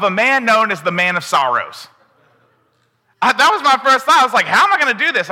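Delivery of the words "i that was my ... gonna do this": high, loud, flustered panic; panicked thought. Retold or imitated. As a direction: play up the panic at the topic. Panicked first reaction.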